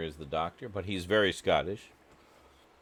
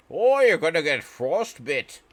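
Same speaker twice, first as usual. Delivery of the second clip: mock Scottish accent; 8 semitones higher, 7 dB louder than usual. Mimicking the clip's Scottish speaker.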